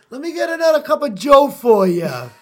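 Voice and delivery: put-on loud, high caricature Brooklyn accent; big mock New York accent, easing as it goes. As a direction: ham it up as a Brooklyn coffee-counter regular. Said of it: "This is a Brooklyn accent".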